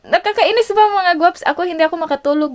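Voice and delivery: high, loud exasperated outburst. Real, not acted. Vlogger vents to viewers about sleepless night, exasperated and loud. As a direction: let the frustration burst out at the viewers.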